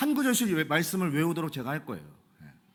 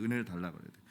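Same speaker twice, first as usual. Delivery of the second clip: soft echo; 13 semitones lower, 12 dB quieter, faster than usual. Shown as a demonstration. Preacher quietly repeating the call to pray for grace. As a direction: repeat it much softer and lower.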